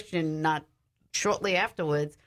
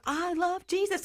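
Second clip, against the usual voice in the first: high, straining release; relieved confession; 13 semitones higher than usual. Retold or imitated. Possessed woman finally saying the words, high strained. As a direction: she finally gets it out. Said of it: "so she finally goes".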